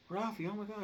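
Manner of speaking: high-pitched mock-shocked mother; alarmed mom imitation. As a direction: cry out like a startled mom. Mom finding son in a fight.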